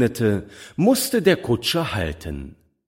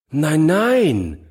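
put-on higher, louder girlish protest; child objecting; 7 semitones higher, 5 dB louder than usual. A narrator's reading. Little girl contradicts her foster mother, higher and louder.

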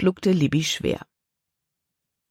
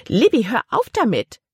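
higher, firmer order; aunt ordering her niece to stop hiccuping; commanding; 7 semitones higher, 3 dB louder than usual. Acted. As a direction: snap out a command. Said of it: "befahl ihre Tante".

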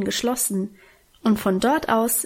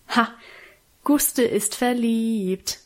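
teasing; slower than usual. A narrator's reading. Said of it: "neckte Alwine".

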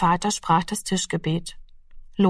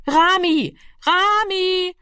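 high, loud calls across the house; calling out; 12 semitones higher, 4 dB louder, much slower than usual. A narrator's reading. Children searching the house call loudly for Rami. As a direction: call for the missing boy. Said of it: "tönte es von hier und dort".

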